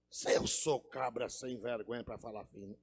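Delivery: low, soft, rough-talking cowboy; gruff low voice. Acted. Cowboy refusing to talk small to the boss, gruff low voice. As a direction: drop the pitch low and defy him. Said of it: "o camarada falava grosso".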